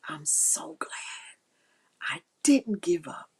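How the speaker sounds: soft whisper, glad you held on; whispering to yourself you're glad you didn't quit; whispered relief. Pretend. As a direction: breathe it like a private thank-you. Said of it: "the days when you say".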